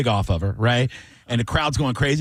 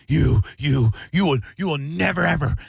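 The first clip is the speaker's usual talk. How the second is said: retold angry threat; 3 semitones higher than usual. Angry old man confronts him.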